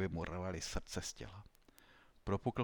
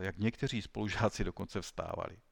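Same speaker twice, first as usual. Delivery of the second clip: ordinary delivery, nothing marked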